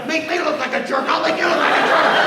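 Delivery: loud, high mock taunt with a laugh; mock revenge. Leader voicing a partner's payback grudge, hammed-up.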